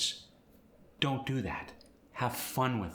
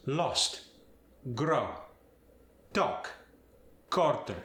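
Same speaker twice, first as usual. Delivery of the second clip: lost, grow, talk, quarter with an open Spanish ah; Spanish accent; 3 semitones higher, slower than usual. Shown as a demonstration. Teacher demonstrating the Spanish ah, Spanish accent. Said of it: "it's more of an ah sound".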